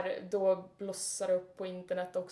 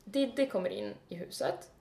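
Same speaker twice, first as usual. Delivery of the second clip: ordinary talk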